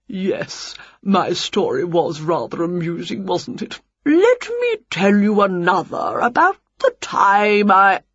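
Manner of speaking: pompous, drawn-out storyteller voice; tedious head teacher holding forth; boring head teacher. A narrator's reading. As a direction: drone on, pleased with your own story. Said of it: "on he went with his boring story".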